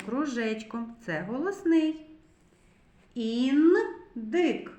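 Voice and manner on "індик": "Індик" with "н" held, then a break before "дик"; second sound singled out. Shown as a demonstration. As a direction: linger on the "н" in "Індик". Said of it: "зробимо звуковий аналіз слова".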